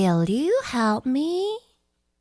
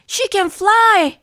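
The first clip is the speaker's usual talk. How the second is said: excited cheer; 7 semitones higher, 6 dB louder than usual. Acted. Proud friend cheering.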